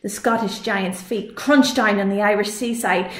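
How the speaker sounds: plain reading voice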